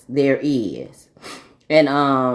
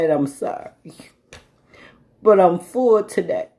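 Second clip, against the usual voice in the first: starting to cry; 2 semitones higher than usual. Real, not acted. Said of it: "and I'm gonna cry".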